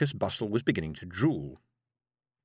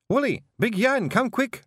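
higher, louder alarmed yell; Scottish wife yelling for help; 11 semitones higher, 4 dB louder than usual. Acted. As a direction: call down for help, urgent. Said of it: "she yelled".

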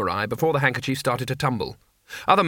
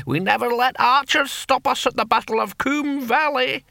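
put-on high, cackling old man; cackling ancient barbarian; 11 semitones higher than usual. A narrator's reading. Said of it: "cackled the antique barbarian".

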